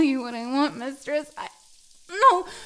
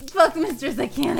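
ordinary delivery

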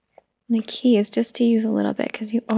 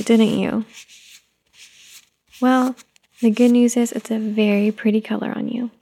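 ordinary talk

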